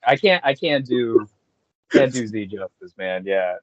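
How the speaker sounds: ordinary talk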